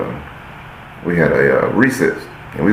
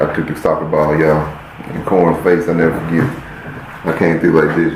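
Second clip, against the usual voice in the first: ordinary talk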